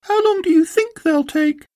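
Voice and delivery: very high-pitched little Roo voice; little Roo asking. A narrator's reading. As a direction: ask as tiny Roo, way up high. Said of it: "asked Roo".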